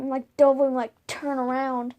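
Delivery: ordinary talk, nothing marked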